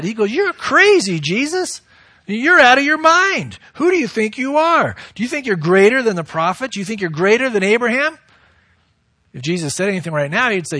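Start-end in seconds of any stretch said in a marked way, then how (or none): 0.3-8.2 acted indignation, pitched high; upset accusers. Offended crowd challenging Jesus over his claims, acted upset. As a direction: challenge him like an affronted crowd, voice raised in pitch. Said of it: "these guys are really getting upset".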